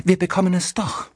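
high-pitched excited cry. A narrator's reading. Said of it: "rief Denise aufgeregt". (aufgeregt und hoch gerufen)

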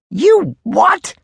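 very high incredulous yelp; outraged. Acted. Major hearing about slippertees, outraged and very high. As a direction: burst out in disbelief.

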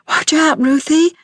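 high, urgent warning shout. A narrator's reading. Rex warns his sister about the lizard, urgent shout. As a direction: yell to stop her stepping on the lizard. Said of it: "He shouted".

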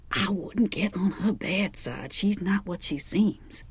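slow, unvoiced warning whisper; a hushed, knowing caution; secretive whisper. Fig confiding a warning at night.

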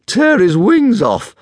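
high, loud, drawn-out howl; howling dragon. A narrator's reading. Dragon joins the jeering crowd, howling. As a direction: howl it slowly like an eager beast. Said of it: "howled Fireworm".

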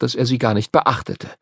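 usual speaking voice

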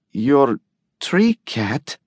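slow, hesitant echo with a touch of fear; thread of fear. A narrator's reading. Patient echoing her doctor's news, a thread of fear. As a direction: echo it slowly, faintly afraid. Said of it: "A thread of fear colored those two words".

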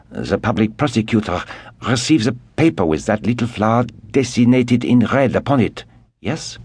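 young Frenchman, lightly French-voiced, curious; a young French nobleman asking eagerly; French Vicomte. Young Vicomte questioning the Englishmen, light French voice.

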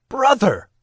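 loud, high call; shouting. Acted. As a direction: call out to him. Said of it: "shouted Sam".

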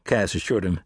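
usual speaking voice

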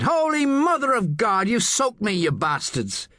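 high-pitched Irish outburst, volume contained; indignant Irish yell, held back; Irish-accented shout. A narrator's reading. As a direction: shout after the car in Irish. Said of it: "he shouted after it".

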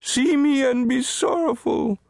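high, keening self-pity; weeping lament. A narrator's reading. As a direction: beg for pity in a sustained high wail. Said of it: "He wept".

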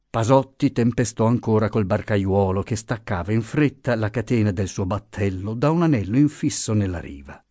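plain reading voice

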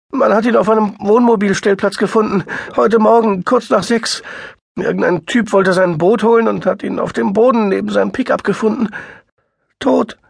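high, loud, fast and frantic; frantic sister near tears; distraught woman. A narrator's reading. Sister tells of the death.

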